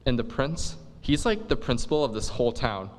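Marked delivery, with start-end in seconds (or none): none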